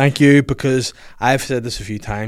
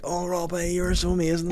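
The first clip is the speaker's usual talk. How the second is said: higher, soft swooning gush; teasing friend as a starstruck fan, higher and breathless-soft; mock fangirl gushing; 7 semitones higher, 5 dB quieter than usual. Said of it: "you'd be like".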